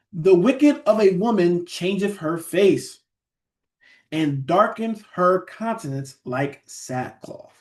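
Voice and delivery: slow, solemn verse reading; scripture reading. Acted. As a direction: read the verse slowly to the congregation. Reads scripture verse aloud.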